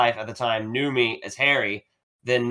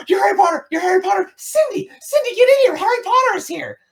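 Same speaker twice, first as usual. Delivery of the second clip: very high, loud excited shrieks; imitating a librarian calling her coworker; screaming fan; 6 dB louder, faster than usual. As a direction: shriek for Cindy like a starstruck librarian. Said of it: "the front desk lady goes".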